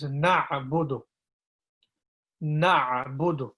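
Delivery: slow "Na'budu" with throat "a"; careful teacher "Na'budu"; slow model. Shown as a demonstration. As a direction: model "Na'budu" slowly for learners. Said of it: "volunteers to try Na'budu".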